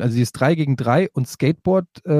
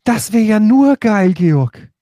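stoned; 7 semitones higher, 6 dB louder, much slower than usual. Real, not acted. Thrilled, drawn out.

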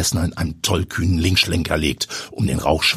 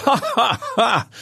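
hearty laugh. Acted. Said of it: "Die Jungs ... nicht in die Pötte".

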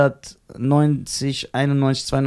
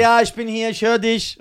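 higher, louder reassurance through a door; father calling through the door to his scared child; 8 semitones higher, 4 dB louder than usual. Retold or imitated. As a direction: call back so the kid hears you.

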